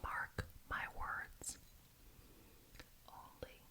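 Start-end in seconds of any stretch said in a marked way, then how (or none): none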